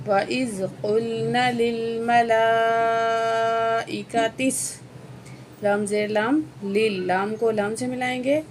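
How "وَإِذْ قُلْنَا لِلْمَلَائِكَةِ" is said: measured recitation of the verse; Quranic recitation. Acted. Teacher to students, reciting the verse. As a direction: recite the phrase in tajweed style.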